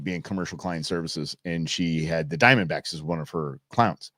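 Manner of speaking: ordinary talk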